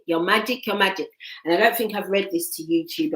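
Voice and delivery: ordinary talk, nothing marked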